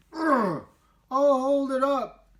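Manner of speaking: high, loud strained grunt then words; straining. Acted. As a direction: push against the wall. Olivia straining against dike.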